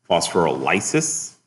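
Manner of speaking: slow phosphorylases, ending "LIE-sis", questioning; contrasted pronunciation. Shown as a demonstration. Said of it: "Everybody hear that?".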